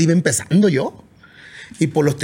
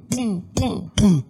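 punchy imitated kick drum thump; mock bass thump; 4 semitones higher, much slower than usual. Musician imitating speaker bass thumps.

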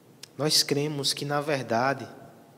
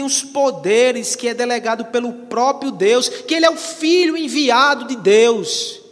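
raised, driving pulpit voice; fervent preaching; 8 semitones higher, 6 dB louder than usual. Acted. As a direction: preach with rising force to the last phrase. Preaches with rising force.

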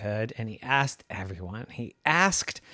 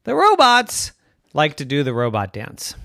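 ordinary delivery, nothing marked

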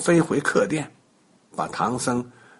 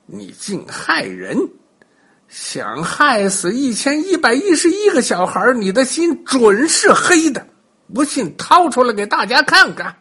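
angry accusation; 8 semitones higher, 3 dB louder than usual. A narrator's reading. 孙悟空怒斥道士.

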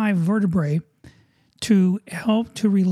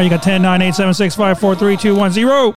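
loud barked countdown; drill sergeant; 7 dB louder than usual. Acted. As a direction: shout the countdown like a drill instructor.

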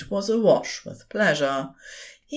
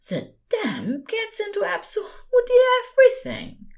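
complaining. A narrator's reading. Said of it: "Aziraphale complained".

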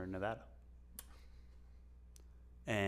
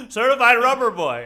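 loud, high dropped-in voice, laughing; goofy high, loud insert; edit insert voice; 11 semitones higher, 18 dB louder than usual. Pretend. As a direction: shout it like a spliced-in overdub. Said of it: "then some voice go".

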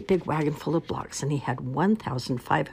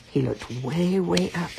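usual speaking voice

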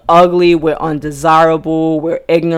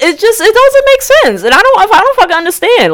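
exasperated high; 12 semitones higher than usual. Real, not acted. Ranting about contradictions.

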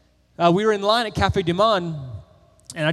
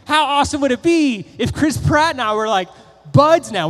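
higher and louder, giddy; starstruck daydream; 7 semitones higher, 7 dB louder than usual. Retold or imitated. Imagining being the celebrity's friend, giddy and loud. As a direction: gush like a starstruck fan.

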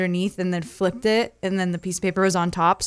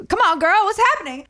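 excited coaxing; 10 semitones higher, faster than usual. Retold or imitated. Coaxing the cat.

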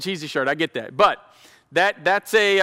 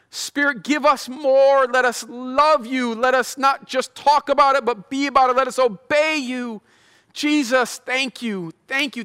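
fervent closing prayer; 5 semitones higher, slower than usual. Acted. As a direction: beg the Spirit with rising intensity.

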